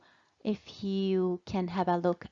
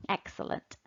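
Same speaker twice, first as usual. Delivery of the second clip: whispered praise; 9 dB quieter, faster than usual. Real, not acted. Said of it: "whispered praise".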